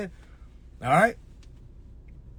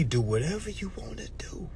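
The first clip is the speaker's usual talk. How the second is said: whisper; 7 dB quieter than usual. Acted. Whispered aside to viewers.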